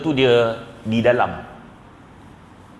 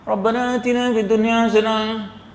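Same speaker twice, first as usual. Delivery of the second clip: half-hearted prayer; 7 semitones higher than usual. Pretend. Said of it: "harapan tu kira sambil-sambil".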